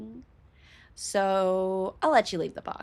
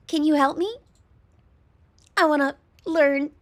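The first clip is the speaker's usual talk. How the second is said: high, cutesy pleading; cute girl voice; 6 semitones higher, 5 dB louder than usual. Acted. Slime girl asking host for help, high and cute. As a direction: ask it high and sweetly innocent. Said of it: "Wobbly, high-pitched, and very cute".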